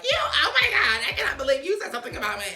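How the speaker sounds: high, loud put-on squeal of disbelief; mock outrage. Mocking Cynthia acting shocked at Nene's words, high indignant squeal. Mocks her shocked reaction.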